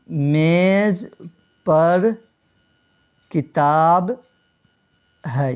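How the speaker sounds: example sentence with long pauses between میز, پر and کتاب; word-by-word dictation. Shown as a demonstration. Teacher setting out an example for students, word by word. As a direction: say میز, پر, کتاب one at a time, widely spaced.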